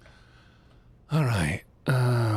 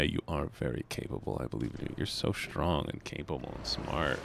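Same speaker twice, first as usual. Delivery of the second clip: soft reassurance; 6 semitones lower, 8 dB quieter than usual. Pretend. Soothing friend after joke.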